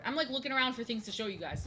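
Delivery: normal talking voice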